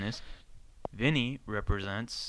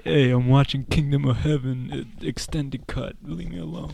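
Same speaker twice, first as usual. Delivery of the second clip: put-on, raised character voice; Johnny Drama; 5 semitones higher, 6 dB louder than usual. Co-host playing a sitcom character, raised irritable put-on voice. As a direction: play a bothered guy wanting to be left alone. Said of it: "The sound of Johnny Drama".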